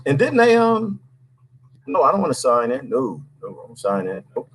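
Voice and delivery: ordinary talk, nothing marked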